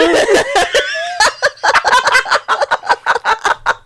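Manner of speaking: high, breaking laugh near tears; helpless laughter. Real, not acted. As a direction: laugh till you can't breathe. Laughing hard at own story.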